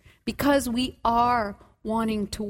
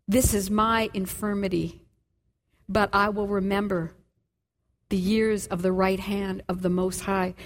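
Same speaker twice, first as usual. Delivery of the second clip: solemn reading; 6 semitones lower than usual. Acted. Preacher reading David's verse to the congregation, solemnly.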